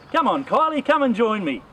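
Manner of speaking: high, loud summoning call. Real, not acted. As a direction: call a friend over to join you. Calls absent colleague to join.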